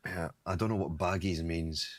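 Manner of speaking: usual speaking voice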